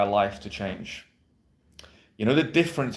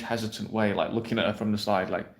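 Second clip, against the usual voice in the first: normal talking voice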